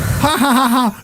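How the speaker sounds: high, forced theatrical cackle; lightly hammed-up chorus laugh; mock stage laughter. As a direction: laugh like a cast of cheering villagers. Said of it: "So, like".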